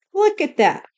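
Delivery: usual speaking voice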